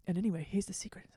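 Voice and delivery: imitated secretive whisper; quick conspiratorial undertone; hushed eavesdropped voice. Imitating game characters heard while eavesdropping.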